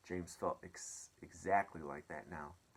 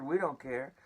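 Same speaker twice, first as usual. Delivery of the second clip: reporters calling back; 6 semitones higher, 8 dB louder than usual. Acted. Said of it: "they answered".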